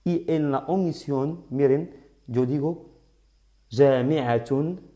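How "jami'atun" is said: JAMI'ATUN with the -tun ending sounded; con doble damma. Shown as a demonstration. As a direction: say "jami'atun" slowly, pronouncing the final "tun". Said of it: "aquí pronunciando doble dama". ("jami'atun" pronunciado con la terminación -tun)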